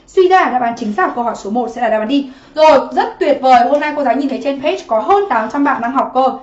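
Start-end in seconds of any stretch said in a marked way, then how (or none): none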